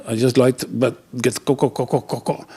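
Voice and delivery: stuck stutter on co- over and over; can't get the word out, stutter it; stammering. Acted. Embarrassed customer asking the teller for condoms, stuttering.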